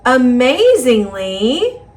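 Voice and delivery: ordinary delivery, nothing marked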